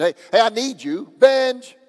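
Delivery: attention-getting yell up the stairs; yelling to someone. Shown as a demonstration. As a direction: call for attention, building to a shout on the name. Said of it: "I'm yelling to you".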